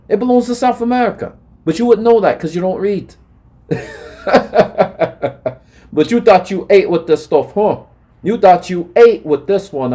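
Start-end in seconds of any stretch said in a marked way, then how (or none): 4.2-5.5 short derisive cackle; mocking laugh. Real, not acted. Speaker ridicules opponent for not reading, mocking laugh. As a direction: laugh it off in scorn. Mocking those who don't read.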